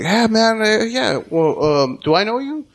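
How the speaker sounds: high, hesitant mock customer voice; confused customer. The rude customer meeting him face to face, high and unsure. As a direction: play the customer caught off guard.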